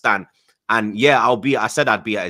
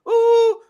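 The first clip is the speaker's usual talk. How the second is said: high squealed ooh; falsetto ooh; 7 dB louder than usual. Retold or imitated. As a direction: shriek a quick falsetto ooh. Reading a comment, mock singer yelp.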